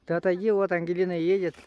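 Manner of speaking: loud warning shout; calling out. Real, not acted. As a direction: shout a warning to get clear. Warns kid on sled path. (громко предупреждает, чтобы отошёл)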